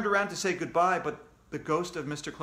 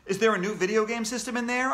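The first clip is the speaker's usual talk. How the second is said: higher, louder, fast eager question; excited; 7 semitones higher, 5 dB louder, faster than usual. Acted. Kid hoping for a video game, excited. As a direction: rush out the hope. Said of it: "I asked excitedly".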